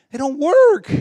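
high, loud retold frustration; exasperated. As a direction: voice shoots up in mock exasperation. Retold frustration with dead lights.

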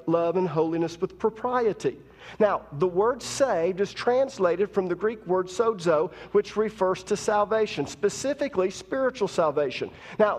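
ordinary talk, nothing marked

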